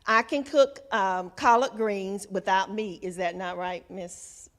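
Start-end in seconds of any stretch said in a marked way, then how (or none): none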